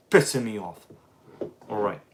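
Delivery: usual speaking voice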